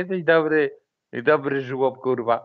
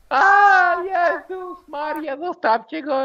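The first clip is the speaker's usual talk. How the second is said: mock pleading voice; 9 semitones higher, slower than usual. Imitating friend defending someone.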